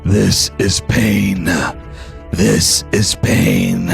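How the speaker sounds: very low, very slow ominous voice; a looming deep voice in the mind. Acted. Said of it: "you hear a deep voice".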